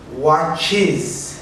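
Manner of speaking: "watches" said with a clear added iz syllable; "watches", two syllables, wa-tchiz; extra syllable. Shown as a demonstration.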